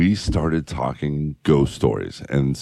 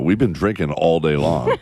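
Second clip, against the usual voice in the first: normal talking voice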